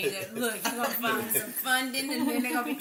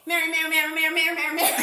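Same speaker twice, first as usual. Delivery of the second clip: vendor calling out; 5 semitones higher, 8 dB louder, faster than usual. Retold or imitated. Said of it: "don't say a name".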